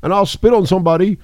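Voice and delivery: lightly voiced old politician; a hint of a mocking Biden impression.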